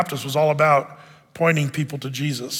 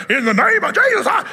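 hammed-up shout, much higher and louder; mock exorcist shout; 12 semitones higher, 5 dB louder than usual. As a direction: bellow it like a showy movie exorcist. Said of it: "you gotta shriek and yell".

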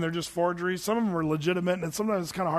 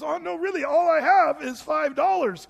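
much higher, mock pleading; pleading Andy; 9 semitones higher, 3 dB louder than usual. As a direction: whine that it's all he has. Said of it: "And he says".

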